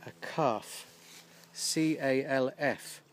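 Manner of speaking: calf said clearly, then spelled C A L F letter by letter; spelled out slowly. Shown as a demonstration. Teacher to learners, spelling calf out slowly and clearly. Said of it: "we say that a baby cow is a calf".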